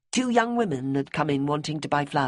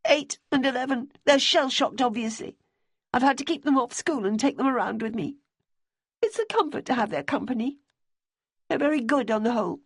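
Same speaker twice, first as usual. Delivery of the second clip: higher, pleading woman's voice; Debbie's voice; 9 semitones higher than usual. A narrator's reading. Debbie asking Simmy about Bonnie's boyfriend, high and earnest. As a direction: voice a worried, earnest widow.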